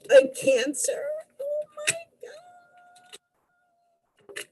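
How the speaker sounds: high, cracking cry of real surprise; startled high cry of disbelief; shocked gasp. Real, not acted. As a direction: react as if the card stunned you. Shocking card appears in reading.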